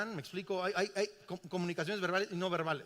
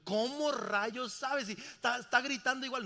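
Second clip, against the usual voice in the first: raised, louder mock exasperation; drawn-out, higher bewildered question; baffled disbelief; 5 semitones higher, 3 dB louder than usual. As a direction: replay a husband's incredulous protest. Retold baffled husband questions wife.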